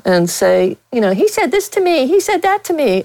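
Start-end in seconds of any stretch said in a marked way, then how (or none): 1.1-3.0 high whiny tattletale voice; mock tattletale voice. Reporter mimicking a colleague running to management, high and whiny. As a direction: whine it like a tattletale to the boss. Mocking a whining complainer.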